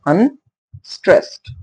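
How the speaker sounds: "Unstressed" pulled apart as un, pause, STRESSED; teacher's two-part "Unstressed", weight on the second part; syllable stress demo. Shown as a demonstration. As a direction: sound out "Unstressed" slowly, rising, in two beats. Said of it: "more effort to say stressed".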